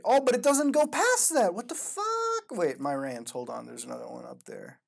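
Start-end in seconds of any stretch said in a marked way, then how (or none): 0.0-2.0 high, loud cry of disbelief; dismayed outburst. Real, not acted. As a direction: protest that the story just stops. Story breaks off as he reads.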